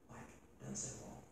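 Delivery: hushed whisper, leaning in. Real, not acted. Preacher whispers to the congregation, stunned. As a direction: let it drop to a breathy secret.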